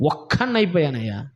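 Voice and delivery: low, sinking voice; retold lonely lament. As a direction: act out the prophet sighing that he is left alone. Voices the prophet left all alone.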